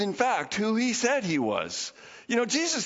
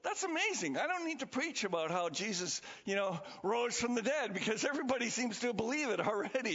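mock amazement; 3 semitones higher, 8 dB quieter than usual. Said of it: "I was like".

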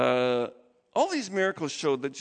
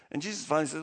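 ordinary talk, nothing marked

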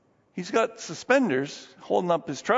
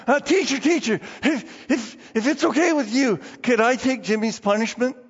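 big Tom pleading; 5 semitones higher than usual. Retold or imitated. Tom offers to take punishment.

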